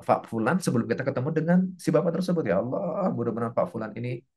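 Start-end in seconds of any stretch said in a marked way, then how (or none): 2.5-4.2 low, subdued blessing; low, earnest supplication; silent-style prayer. Shown as a demonstration. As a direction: drop into a private prayer for a client. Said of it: "Kan kita bisa ngedoain".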